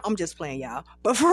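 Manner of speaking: plain reading voice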